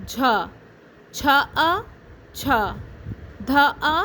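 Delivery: put-on teacher sounding out syllables for kids to repeat; syllable drill. Shown as a demonstration. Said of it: "میرے ساتھ رہیے گا۔". (بچوں کو آوازیں جوڑ کر سکھاتی استانی)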